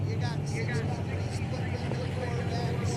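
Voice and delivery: booming over the loudspeaker; PA announcer. Real, not acted. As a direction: call it out over the PA. Announcing cars over loud PA.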